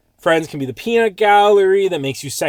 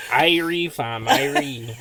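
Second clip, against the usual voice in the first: put-on slow drawled Toronto accent; mock Toronto slang; 4 semitones higher, much slower than usual. Host recalling doing his Toronto accent, drawled slowly. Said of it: "I remember doing that accent".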